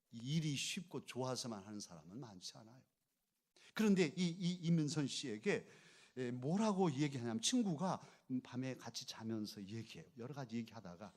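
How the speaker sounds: usual speaking voice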